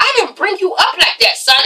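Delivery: high, disappointed mom voice; a hint of a stern mother; mother scolding her son over his video. Voicing Mama D scolding.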